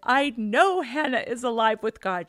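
loud, insistent retold outburst; exasperated reply. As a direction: snap back loudly, as a fed-up mother. Retelling her exasperated reply to child.

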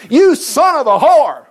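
loud, slow, high jeer; taunting brothers. Retold or imitated. Brothers jeering at Jephthah as they drive him out, loud and slow. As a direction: spit the insult out slowly.

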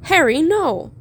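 high, loud alarmed cry; yelled. A narrator's reading. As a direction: yell out to stop a friend. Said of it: "Hermione yelled".

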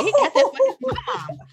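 high, laughing mock excuse; grandma inventing an excuse for the baby, high and laughing. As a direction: giggle out the grandma's cover story. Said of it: "She gonna say".

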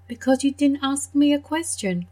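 a hint of playful donkey; amused donkey. Donkey answers the cow.

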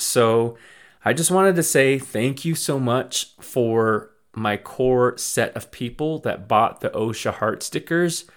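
ordinary talk

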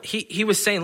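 usual speaking voice

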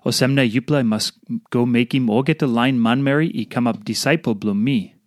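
normal talking voice

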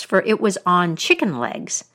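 plain reading voice

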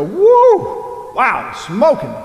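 high, loud whooping "Woo!" then an amazed "Wow, smoking"; excited whoop. Pretend. Preacher mimicking a thrilled Bible listener, high loud whoop. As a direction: whoop it up like hearing great news. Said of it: "we would hear those words and go".

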